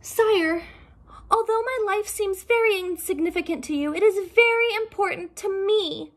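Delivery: high, timid rabbit voice; nervous. Acted. Rabbit pleading nervously with the Dragon King, high and timid. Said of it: "the rabbit said nervously".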